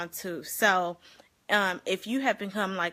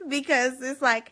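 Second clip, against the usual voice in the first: usual speaking voice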